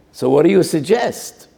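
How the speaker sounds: high, loud demanding question; loud challenge. Acted. Lender demanding a plan from the borrower, loud and high. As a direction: press him for an answer.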